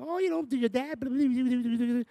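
high mock mother prattle; imitating chatty mom, high voice; mom chattering. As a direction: rattle off mom's news, pitched high. Mom chatting in retold call.

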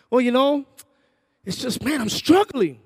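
mock whiny son admitting struggle; pleading son. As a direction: let it burst out high to mom. Son confessing to mom.

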